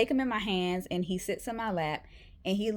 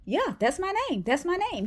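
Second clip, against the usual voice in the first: high, eager dog's inner voice; the puppy thrilled at hearing his name; high eager puppy voice, repeating happily; excited dog voice; 10 semitones higher than usual. Retold or imitated.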